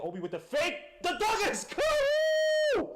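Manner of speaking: high, loud shout erupting on the dunk; excited dunk call. Real, not acted. Streamer calling a big dunk live, excited shout. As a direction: let the call explode into a high yell.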